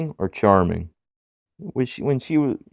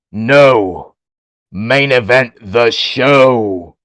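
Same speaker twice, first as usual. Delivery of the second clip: Wade Barrett impression; 7 dB louder, much slower than usual. Barrett voice telling Roddy.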